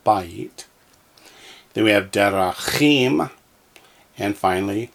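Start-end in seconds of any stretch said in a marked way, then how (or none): 0.0-0.6 "bayit" as BA-yit, first syllable stressed. Shown as a demonstration. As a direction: lean on the start of "bayit". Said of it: "that one accents on the first syllable".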